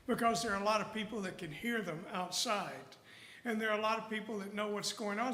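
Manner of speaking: normal talking voice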